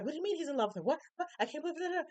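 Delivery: high, incredulous cries of disbelief; shocked disbelief. Retold or imitated. Family hearing the gossip, in high shocked disbelief. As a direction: gasp it out like you can't believe it.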